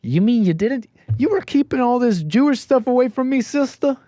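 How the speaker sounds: high, whiny mock accusation; mock caller voice. Host imagines the caller scolding his sister, in a high mock voice. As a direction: squeak it out like a betrayed brother.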